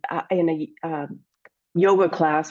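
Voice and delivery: ordinary delivery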